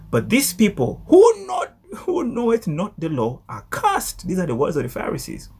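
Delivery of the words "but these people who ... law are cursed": high, slow, drawn-out condemnation; scornful pronouncement. Acted. Preacher reading the Pharisees' verdict on the people, scornful pronouncement. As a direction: stretch it out as a dramatic denunciation. Reads the Pharisees' curse dramatically.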